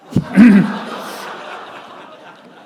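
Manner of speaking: a loud, breathy burst of laughter; hearty laugh. Real, not acted. Recalling the partner's joke.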